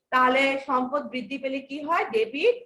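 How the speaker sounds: ordinary talk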